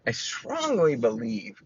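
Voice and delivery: normal talking voice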